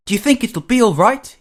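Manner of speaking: high-pitched eager question; bubbling anticipation; excited. A narrator's reading. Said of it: "Henry was excited all day".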